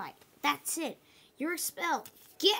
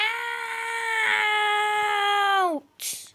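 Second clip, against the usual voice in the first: a long, loud, higher crying wail; anguished wail; 8 semitones higher, 7 dB louder than usual. Acted. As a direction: wail in despair at being thrown out. Student begs not to be expelled.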